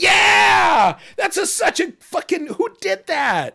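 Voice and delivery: real thrilled outcry; delighted shout. Real, not acted. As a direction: yell out your joy at the art. Delighted at the card art.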